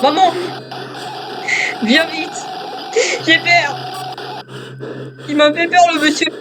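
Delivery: put-on high, pleading child voice calling for mum; mock-frightened high whimpering kid; scared little child.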